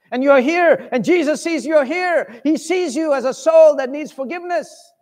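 high, loud pleading sermon; fervent altar call. Acted. Preacher appealing to the congregation to come, high and fervent. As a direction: reach out to every listener.

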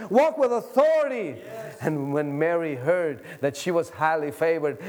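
normal talking voice

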